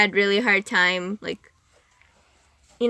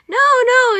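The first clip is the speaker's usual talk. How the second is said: much higher, louder teacher voice; imitating the teacher; 7 dB louder than usual. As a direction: chirp it like a sweet surprised teacher. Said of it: "she's just like".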